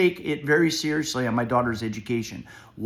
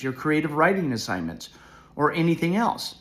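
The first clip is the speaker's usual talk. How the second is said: ordinary talk, nothing marked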